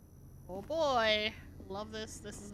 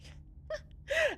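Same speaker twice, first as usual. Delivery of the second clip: nervous laugh. Real, not acted. Nervous laugh after jump scare.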